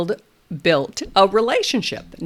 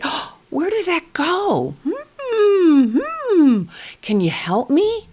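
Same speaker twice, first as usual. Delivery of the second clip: high, louder wondering hums; inviting play voice; 10 semitones higher, 5 dB louder, slower than usual. Shown as a demonstration. Therapist inviting a toddler to help, high wondering hums. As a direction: ask for help with playful curiosity. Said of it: "I look at him and I say".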